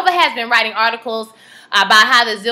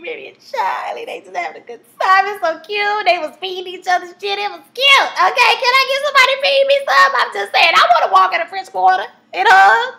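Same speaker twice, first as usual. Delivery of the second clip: gushing excitement; 8 semitones higher than usual. Real, not acted. Gushing over the cute date.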